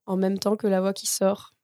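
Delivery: ordinary delivery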